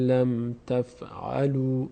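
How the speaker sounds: لم تفعلوا said slowly with لم long; slow recitation demo. Shown as a demonstration. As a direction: draw out لم تفعلوا deliberately. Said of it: "میشه فعل لم".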